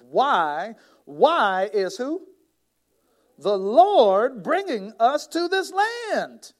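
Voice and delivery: high, loud, slow whine; mock the grumbling Israelites; drawn-out high whine; whiny complainer.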